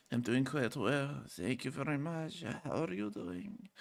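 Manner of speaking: very soft, slow mumbled reply; mumble rapper. Acted. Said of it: "great career as a mumble rapper".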